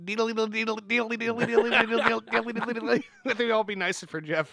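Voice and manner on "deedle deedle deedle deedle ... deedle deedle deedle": rapid sing-song "deedle-deedle" on one held pitch; comic scurrying noise. Acted. Player scoring Carl's dash to his cabin, comic scurry. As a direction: patter out cartoon running music.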